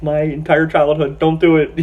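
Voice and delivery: high, loud mock-horrified pleading; dismayed plea. Fan begging as his childhood hero is stabbed, dismayed plea. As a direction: plead like a distraught fan at the screen.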